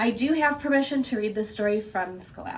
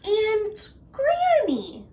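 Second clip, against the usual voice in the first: high, slow and careful; wary little girl; 12 semitones higher, 4 dB louder, much slower than usual. Acted. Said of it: "said carefully".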